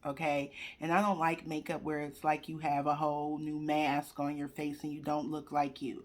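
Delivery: normal talking voice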